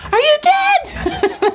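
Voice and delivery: very high, slow, laughing "are you dead"; mock panic. Retelling shaking the floppy piglet, high slow laughing panic. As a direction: ham up the panic while laughing. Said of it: "And I'm, like, shaking him".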